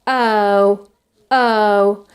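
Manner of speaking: long "oh" sliding from uh towards u; schwa-start diphthong. Shown as a demonstration. Teacher showing schwa going towards u, central glide. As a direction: stretch "oh" from a central vowel. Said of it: "it starts with a schwa quality".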